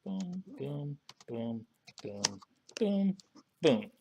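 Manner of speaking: playful vocal drum hits; beatboxed boom drumroll. Real, not acted. Drum sound before the topic.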